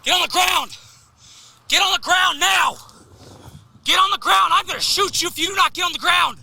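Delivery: shouted surrender demands. Real, not acted. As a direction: yell the orders with full force. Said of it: "cop shouting at suspect".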